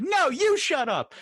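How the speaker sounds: high, slowed-down squabbling comeback; childish retort. Retold or imitated. Everybody else snapping back at the Warren people, high childish retort. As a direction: fire it back like a bickering kid. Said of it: "everybody else being like".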